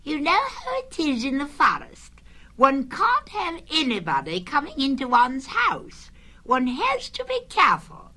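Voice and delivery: very high, put-on character voice; fussy Rabbit. A narrator's reading. Rabbit excusing his caution to Pooh, high fussy voice. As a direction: explain yourself high and primly.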